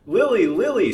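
high, loud panicked scream. Acted. Ada screams for her vanished sister, loud shriek. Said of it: "screamed Ada".

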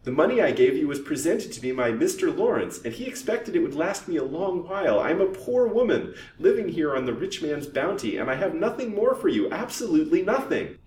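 high, loud desperate protest. A narrator's reading. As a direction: insist desperately to a blackmailer. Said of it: "in her desperation".

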